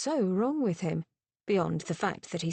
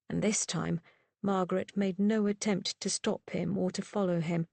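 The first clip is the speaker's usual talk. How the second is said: ordinary delivery, nothing marked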